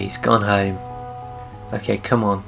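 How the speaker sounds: ordinary delivery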